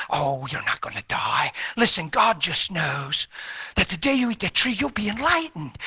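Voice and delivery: low, soft, coaxing tempter; serpent's voice. Retold or imitated. The tempter in Eden coaxing Eve, low and soft. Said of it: "the voice came and said".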